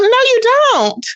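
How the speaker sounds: ordinary talk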